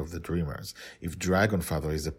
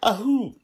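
after-cough whoop; 6 dB louder than usual. Real, not acted. Cough outtake.

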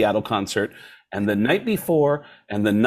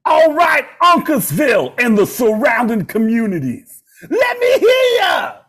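high, loud rock-star crowd shout; 12 semitones higher, 7 dB louder than usual. Retold or imitated. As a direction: belt it to the arena like a rock frontman. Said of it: "[name] on stage said".

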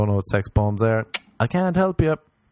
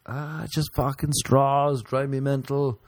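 ranting voice; 7 semitones higher than usual. Acted. Ranting in put-on voice.

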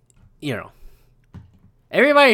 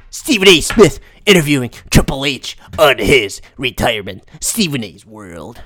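Stephen A. Smith; 7 semitones lower than usual. Acted. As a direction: announce it with weight, drawing out the last words. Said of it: "I'll read it like Stephen A".

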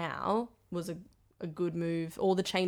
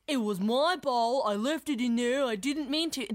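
higher, stretched-out mimicry; Michael impression; 5 semitones higher than usual. Performer demonstrating how the line could be said, exaggerated mimicry. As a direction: play up the bandmate's odd voice. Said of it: "who does the, uh, Michael impression".

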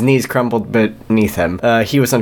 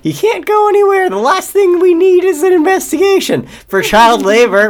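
very high, louder squeaky warden voice; the warden's voice; 4 dB louder than usual. Acted. Warden waves off the guards in a very high squeaky voice. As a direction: play the warden as a squeaky cartoon villain. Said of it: "said the warden".

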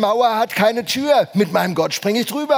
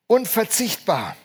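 usual speaking voice

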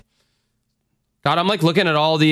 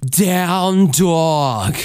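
higher, stretched-out Down dog in a yoga accent; 5 semitones higher than usual. Shown as a demonstration. Showing listeners how fun it sounds, yoga accent. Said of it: "fun to say it in that accent".

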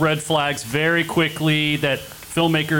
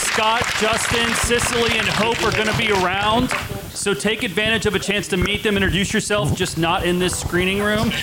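higher, upbeat host announcement; announcer voice; 9 semitones higher than usual. Acted. Host announces next screenings.

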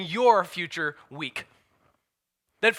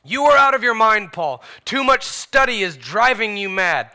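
raised, scolding outburst; loud exclamation; 2 semitones higher, 6 dB louder than usual. Acted. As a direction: exclaim it loudly, as Festus accusing. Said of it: "exclaimed in a loud voice".

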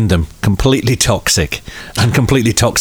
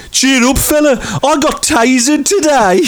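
high-pitched imitation in a West Midlands accent. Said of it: "He had a West Midlands accent".

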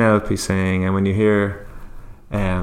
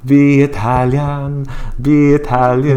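put-on higher, slow sung Italian accent; 5 semitones higher, 4 dB louder, slower than usual. Insisting to doubting co-host, sung Italian accent. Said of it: "she puts on the Italian accent".

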